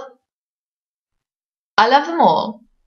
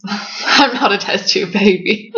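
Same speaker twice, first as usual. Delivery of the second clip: laughing through a slower line; slower than usual. Real, not acted. Author laughing off her own test tube joke. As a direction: let the words come out in a giggle.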